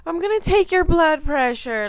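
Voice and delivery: high, loud fake-cheerful greeting; hella loud. Nurse slamming the door open at night, loud and high. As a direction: boom it out as if barging into the room. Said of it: "like, hella loud".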